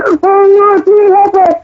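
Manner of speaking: mock cry for help, pitched high; imitating desperate pleading. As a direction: beg like a frightened crowd. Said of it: "you wanna call him out".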